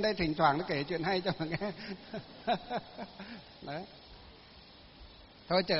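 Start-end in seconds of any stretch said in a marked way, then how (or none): 2.5-3.4 soft, amused laugh; joking chuckle. Real, not acted. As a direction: chuckle after your own joke. Jokes about telling stories. (cười đùa nhẹ)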